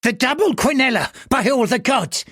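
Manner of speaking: put-on high, pleading old gambler's voice; an old man urgently placing his bet; aged bettor, high and eager; anxious old man. Old man character voice in narration.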